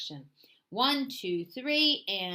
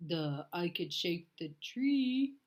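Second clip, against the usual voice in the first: slow dim bear; 2 semitones lower than usual. A narrator's reading.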